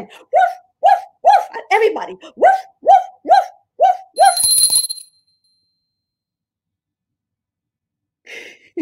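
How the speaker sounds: high playful woof woof; dog barks. Shown as a demonstration. Teacher showing kids a dog's bark, high and playful. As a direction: bark like an excited little dog. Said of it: "making noises like woof".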